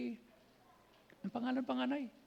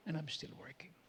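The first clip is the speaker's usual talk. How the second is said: hushed, breathy whisper; 5 semitones lower, 8 dB quieter than usual. Retold or imitated. Actor's quote, ending in a whisper. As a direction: let it fall to a secret whisper. Quoted McConaughey, closing line.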